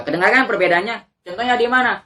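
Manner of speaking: ordinary talk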